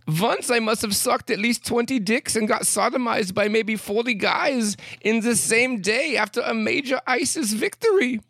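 high-pitched mock German accent. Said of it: "saying this in a German accent".

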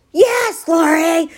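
yelled high and loud, exasperated; annoyed shout. Retold or imitated. Husband shouting at wife, annoyed at her question. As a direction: snap it out at Lori, fed up. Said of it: "like, so annoyed".

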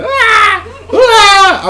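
very high, loud panicked screams; screaming substitute. Retold or imitated. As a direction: scream like a terrified sub. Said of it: "the substitutes going".

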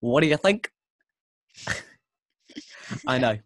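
bad Kiwi accent, asking; terrible New Zealand accent. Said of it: "This is my terrible New Zealand accent".

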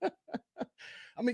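laughing through the words; real amused laughter. Real, not acted. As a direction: break into a laugh mid-line. Laughs at the rival.

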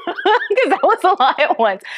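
high, laughing self-aware aside; amused at your own rambling. Real, not acted. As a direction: talk through real laughter.

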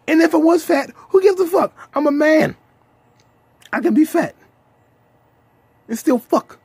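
loud, high defiant rant; defiant shout. Real, not acted. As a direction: shout it out proudly like a boast.

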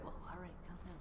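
softly soothing his wife; mild calming reply. Retold or imitated. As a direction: ease her down under your breath. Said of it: "And I'll be like".

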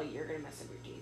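low, soft mock-mom warning; a lightly voiced nagging mother; mom's warning. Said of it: "She's all".

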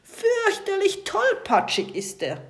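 viel höher und lauter, langsam; wütend lospoltern; polternd. A narrator's reading. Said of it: "poltert der Kapitän jetzt wieder los". (Kapitän poltert laut über den Tintenfisch)

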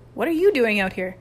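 loud, indignant question; angry demand. A narrator's reading. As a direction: confront him, outraged. Chip angrily confronting Rudy.

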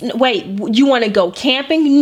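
ordinary delivery

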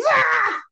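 sudden high mock scream of release; frustrated scream. Alone in the break room, venting before facing a coworker, a mock scream. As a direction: let it all out in one short pretend yell. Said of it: "go to the break room or whatever and just be like".

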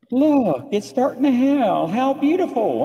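imitating friend. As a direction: gush lightly over the hail. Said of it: "And she goes".

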